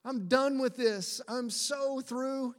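high, slow, whining complaint; drawn-out whiny plea. Retold or imitated. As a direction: whine it out like a man who's given up. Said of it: "I think he's using his whiny voice".

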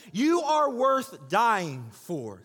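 each word drawn out slowly; very slow, weighted delivery; slow emphasis. Acted. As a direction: lay it down slowly and deliberately. Slow emphatic line to woman.

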